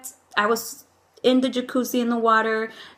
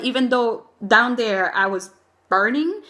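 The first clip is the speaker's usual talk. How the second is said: ordinary talk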